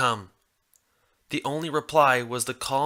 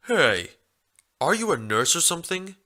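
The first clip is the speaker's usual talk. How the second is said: curious stallion, quick higher question; 5 semitones higher than usual. A narrator's reading. Stallion notices her cutie mark, curious quick question. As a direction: wonder aloud, a bit quick. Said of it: "the stallion asked curiously".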